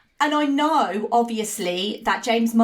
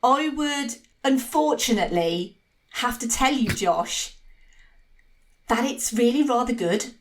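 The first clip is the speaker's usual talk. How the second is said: suspenseful verdict. Real, not acted. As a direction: hold back the verdict, pausing between phrases. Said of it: "you had me on a roller coaster there".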